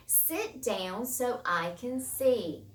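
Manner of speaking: drawn-out, deliberate command; spaced-out classroom example; slow example reading. Shown as a demonstration. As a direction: read the command slowly and clearly.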